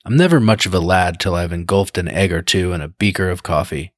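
ordinary delivery

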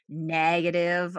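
air-quoted word. Shown as a demonstration. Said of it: "I'm putting my fingers in finger quotes".

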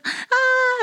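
high squeaky panicked yelp; a sudden high yelp of panic. Retold or imitated. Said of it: "I was like".

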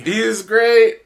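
loud, high excited shout then laugh. Real, not acted. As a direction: burst out with joy. Excited about toy.

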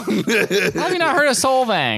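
high, drawn-out disbelief; high-pitched slow mock shock; mock incredulous. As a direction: tease them with exaggerated astonishment. Teasing co-host's ignorance of a town.

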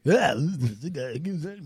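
mimicked radio-host delivery; radio guy voice. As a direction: put on a radio guy's voice. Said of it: "You can tell by his voice".

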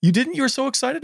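high, incredulous disbelief; genuinely amazed he skipped them; surprised. Real, not acted. Friend didn't buy skeletons.

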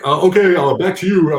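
a host scrambling to cut away; flustered host. Retold or imitated. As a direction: TV host caught off guard on live air, flustered.

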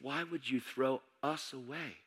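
soft, slow retold plea; hurt plea. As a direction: ask quietly, as a wounded friend. Hurt friend pleads against his leaving.

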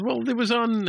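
high mock voice. Mariner shrugs off phaser shot.

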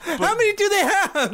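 high-pitched mock holler; put-on high incredulous yell; yelling out the window. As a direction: holler it at unseen noisemakers outside. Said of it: "Just yell at the window".

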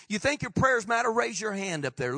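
ordinary talk, nothing marked

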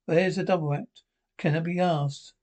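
usual speaking voice

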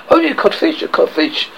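high first phrase trailing into voiceless disbelief; stunned storybook repetition; blank echo. A narrator's reading. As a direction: echo it back, taken aback. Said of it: "echoed bl-, blankly".

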